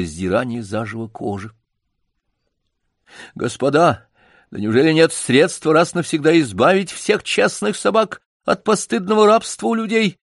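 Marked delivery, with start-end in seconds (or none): 3.3-10.2 высоко, горячо выкрикивая; Джек запальчиво призывает собак; запальчивый крик. Acted. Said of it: "крикнул запальчиво Джек". (запальчиво, высоким голосом)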